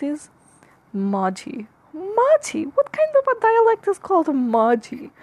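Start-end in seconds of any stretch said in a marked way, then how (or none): 1.9-2.7 Majhi thrown back high, in disbelief; mock-baffled Majhi; incredulous echo. Incredulous echo of dialect name. 2.7-5.1 high, incredulous outburst; baffled, pitched-up scoff; mock disbelief. Mock disbelief at dialect name.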